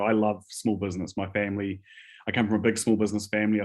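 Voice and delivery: normal talking voice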